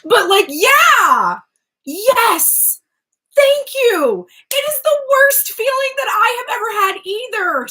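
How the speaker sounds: loud, high exclamations of relief; emphatic agreement. Real, not acted. Reader agreeing it's the worst feeling, loud and emphatic. Agreeing loudly after laughing fit.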